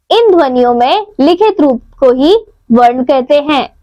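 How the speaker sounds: ordinary talk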